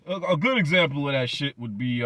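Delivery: ordinary talk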